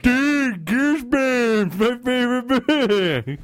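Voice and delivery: high, loud, slow fake enthusiasm; mocking gush. Real, not acted. Mocking Sam's video, high sarcastic gush.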